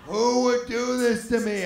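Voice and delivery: high, loud, drawn-out melodramatic cry; imitating a devastated man's howl; mock anguished wail. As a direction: wail to the heavens in hammed-up despair. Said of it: "He falls to his knees and goes".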